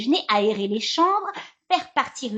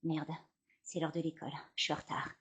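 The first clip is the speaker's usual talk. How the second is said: panicked whisper; 7 semitones lower, 14 dB quieter, faster than usual. Real, not acted. Panicked whisper, late for school.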